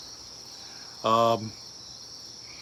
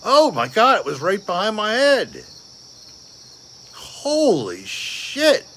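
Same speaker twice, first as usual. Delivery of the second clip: frightened cry; 9 semitones higher, 6 dB louder than usual. Real, not acted. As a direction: yelp as if something brushed your head. Thinks something flew behind him.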